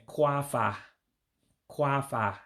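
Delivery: "kwafa" as KWAH-fah, two clear syllables; model pronunciation. Shown as a demonstration. Teacher models the word for per year, clearly pronounced. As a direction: say "kwafa" twice, carefully, pausing between. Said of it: "that's why you're hearing me saying".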